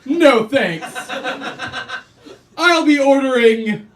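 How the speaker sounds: milk the moment like a big shot; smug customer. Retold or imitated. Smug customer showing off to the cashier, slow and loud. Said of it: "I'd be like".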